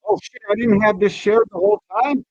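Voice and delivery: usual speaking voice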